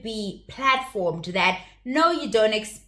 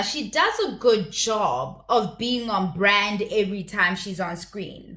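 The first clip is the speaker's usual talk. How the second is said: ordinary delivery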